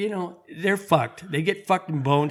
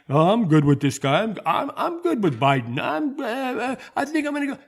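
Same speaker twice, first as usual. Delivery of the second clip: a mocking, dopey voter voice that climbs much higher at the end; mocking dim voter; 7 semitones higher than usual. Mocking Biden voters, imitating one as a clueless fool. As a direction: sneer at Biden voters by playing them as clueless. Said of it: "you other people in America are saying".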